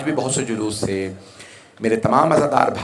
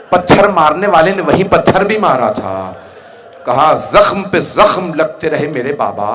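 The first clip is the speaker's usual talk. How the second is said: loud, raised, forceful majlis oratory; impassioned lament preaching; 5 semitones higher, 7 dB louder than usual. Acted. Preacher recounting the martyr's wounds to mourners, loud impassioned delivery. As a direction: drive the grief out to the whole congregation.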